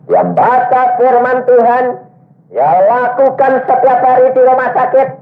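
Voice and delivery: high, ringing sermon voice; preaching at full force. Acted. Preacher telling the congregation, at full force. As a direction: lift it up and preach it to the hall.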